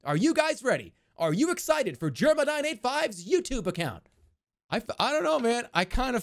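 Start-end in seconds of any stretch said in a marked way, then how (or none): 0.0-4.1 high, energetic address to a crowd; crowd hype-man. Acted. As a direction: rev up a big audience. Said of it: "speak to a crowd of people".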